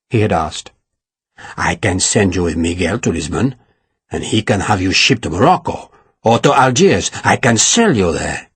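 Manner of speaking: low, gloating threat; a cruel man enjoying her fear; low menacing villain. A narrator's reading. Narrator voices villain Ferragus.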